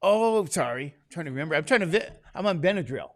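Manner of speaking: normal talking voice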